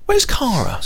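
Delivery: put-on high childlike question; little girl asking. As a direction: ask like a three-year-old missing her sister. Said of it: "she'll say to me".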